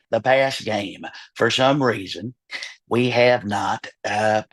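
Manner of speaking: low, slow Texas drawl; Jerry Jones impression. Team owner musing on pass rush, slow low drawl. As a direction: drag it low like an old owner. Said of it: "the Jerry would be way down here".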